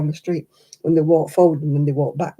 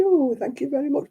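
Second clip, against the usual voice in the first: higher, flattered "Oh, thank you very much"; 9 semitones higher than usual. Retold or imitated. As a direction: gush a pleased thank-you.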